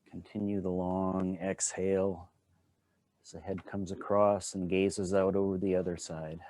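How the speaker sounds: unhurried, calm guiding voice with long pauses; guided qigong instruction. Acted. Teacher guiding a standing qigong class, calm and unhurried. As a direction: talk the class gently through each movement.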